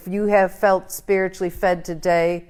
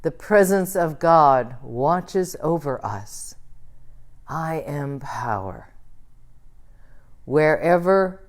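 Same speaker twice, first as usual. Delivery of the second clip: slow call and response; 2 semitones lower, slower than usual. Shown as a demonstration. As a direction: lead the room slowly, line by line. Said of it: "I'll say it slowly".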